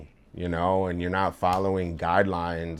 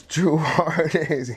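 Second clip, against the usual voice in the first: high, slow name call breaking into a laugh; laughing while imitating the deranged customer; laughing imitation; 7 semitones higher, slower than usual. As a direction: try to do the guy but crack up.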